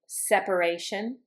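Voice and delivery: "Separation" said sep-uh-RAY-shun; A pronounced. Shown as a demonstration. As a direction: sound the A after "sep" in "Separation". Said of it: "I pronounce the A in the noun".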